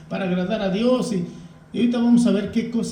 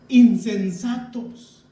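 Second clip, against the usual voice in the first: Insensatos drawn out slowly, each syllable weighed; stern slow rebuke; 3 dB louder, much slower than usual. Retold or imitated. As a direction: dice "Insensatos" despacio y con peso. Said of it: "les dice más feos". (reproche lento y severo)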